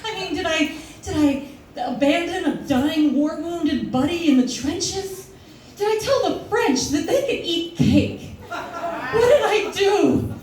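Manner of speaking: frantic inner panic. Retold or imitated. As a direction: spiral into panicked guesses. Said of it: "all I could think about was".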